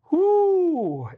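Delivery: high, loud joyful whoop; exultant whoop. Real, not acted. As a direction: let out a shout of praise. Exultant shout in prayer.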